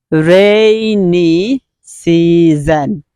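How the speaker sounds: "Rainy season" hugely stretched, RAAAI-NY SEEE-ZON; slow model pronunciation. Shown as a demonstration. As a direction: draw out each syllable of "Rainy season".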